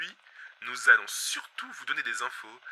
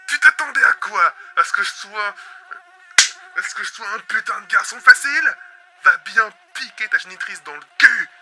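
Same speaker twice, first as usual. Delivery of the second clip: higher, louder contemptuous rant; angry rant; 7 semitones higher, 8 dB louder than usual. Acted. Host ranting at the escaped bug, angry. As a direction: spit insults at the creature.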